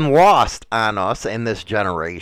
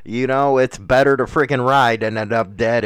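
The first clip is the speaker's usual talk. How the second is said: ordinary talk, nothing marked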